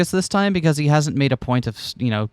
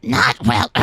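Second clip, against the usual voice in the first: gruff hard-boiled detective line; noir detective; 11 semitones higher than usual. Acted. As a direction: deliver it like a weary noir detective. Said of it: "You sounded like a detective for a moment there".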